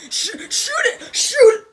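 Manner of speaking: panicked yell. Real, not acted. Gamer yelling in panic at the monkey.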